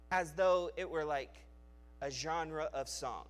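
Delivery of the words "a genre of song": normal talking voice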